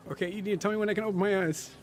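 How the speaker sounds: put-on high, quick, anxious child's voice; scared little girl. Girl asks her friend to say when she can look, in a high anxious voice. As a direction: blurt it out like a scared kid with eyes shut. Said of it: "and you see her, like, kind of like".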